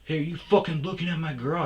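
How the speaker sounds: imitating a swaggering tough guy; tough guy challenge. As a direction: square up like a cocky bully. Tough guy confronts narrator.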